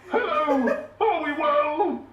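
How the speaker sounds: high, slow bouncy greeting; Michael McIntyre impression. Impressionist greeting the audience as Michael McIntyre. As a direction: greet the crowd like a chirpy host. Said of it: "I'll do that impression again".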